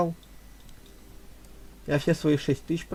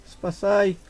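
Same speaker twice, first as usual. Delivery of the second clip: normal talking voice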